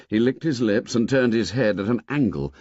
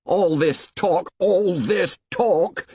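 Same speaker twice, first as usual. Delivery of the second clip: Ralph shouting at the assembly; drawn-out exasperated shout; 10 semitones higher, 3 dB louder, slower than usual. A narrator's reading. Slow, pitch high, a boy fed up with talk. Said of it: "shouted Ralph".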